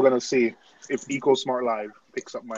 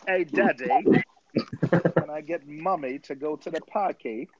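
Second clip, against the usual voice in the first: put-on higher-pitched posh British child, with a laugh; Peppa Pig; 5 semitones higher than usual. Child asking daddy about the park, mock Peppa Pig voice. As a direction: wheedle daddy like a cartoon British kid. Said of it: "that's Peppa Pig".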